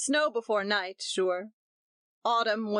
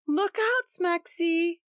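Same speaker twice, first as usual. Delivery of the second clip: warning cry; 10 semitones higher, slower than usual. A narrator's reading. Nursery chatter upstairs.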